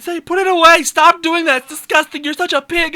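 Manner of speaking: high, loud mock outrage; angry scolding. Girlfriend ordering boyfriend to stop, high angry scolding. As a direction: yell it as a disgusted girlfriend.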